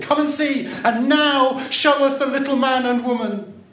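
put-on high, loud children's call; acted high childish calling. A narrator's reading. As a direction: shout it across as eager children. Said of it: "the children called".

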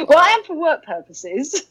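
giggling through the words; real laughter breaking through; laughing. Real, not acted. Laughs at own joke.

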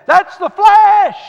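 high, loud, slow, drawn-out proclamation; full-force preacher cry; preaching shout. Real, not acted. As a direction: thunder it from the pulpit. Preacher's climactic declaration.

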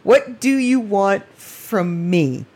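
slow, stretched, disbelieving repeat; drawn-out retold plea. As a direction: drag each word out in exasperation. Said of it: "And I'm like".

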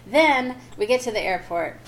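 ordinary delivery

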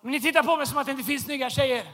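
ordinary delivery

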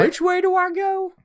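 high, lost, panicky voice; lost country boy. Retold or imitated. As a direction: play a confused country boy in the city. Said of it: "I'd be like".

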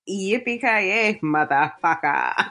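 slow, lowering mock German accent; German villain accent. Mimicking the villain's line, slow put-on German accent. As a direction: draw it out in a badly imitated German accent. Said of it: "his German accent".

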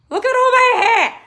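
high, loud squeal at her own hair; delighted squeal. Real, not acted. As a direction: squeal with joy.

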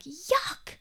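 mock disgusted squeal, high up; high pitched squeal. Teen squealing at a rat in her room. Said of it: "high pitch squealing".

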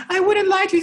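very high, wailing imitation of her crying; high tearful plea, hammed up; mock crying woman. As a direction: whimper it in a high, sobbing falsetto. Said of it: "she's like".

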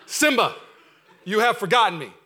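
loud, mock-regal lion king voice; hammed-up loud lion father; booming Mufasa. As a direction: boom it out like the king of Pride Rock. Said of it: "I'm not gonna try Dream Zero".

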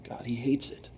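hushed whisper, almost breathless; whispered aside. Real, not acted. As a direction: drop to a secret whisper. Sudden aside after quoted prayer.

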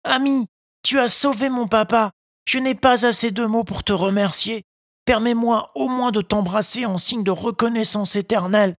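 a grateful puppet, lightly choked with emotion; deeply moved. A narrator's reading. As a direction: thank the tuna, touched and grateful. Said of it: "d'une voix très émue".

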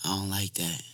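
soft, low, hushed request; whisper. Pretend. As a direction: say it quietly, almost whispering to her. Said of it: "you gotta, like, whisper to her".

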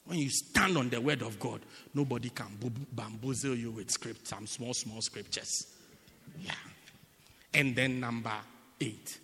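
usual speaking voice

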